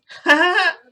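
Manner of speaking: high, loud burst of laughter; acted-out bark of amused disbelief; incredulous laugh. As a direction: laugh it off as a joke, loudly. Principal laughs in disbelief at confession.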